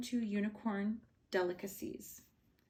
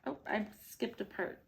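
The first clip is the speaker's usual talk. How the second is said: ordinary delivery, nothing marked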